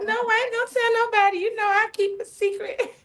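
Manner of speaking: high, quick mom impression; squeaky mock-mom promise; imitating sweet mom. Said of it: "That's how she talks. She's so sweet".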